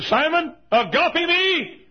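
high, loud, drawn-out agape me; God's voice pleading high and slow; loud slow plea. Shown as a demonstration. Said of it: "And then he said".

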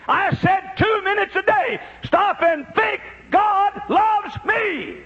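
high, ringing preacher's climb; rising sermon fervor; preaching at full force. Acted. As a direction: build the praise to a peak.

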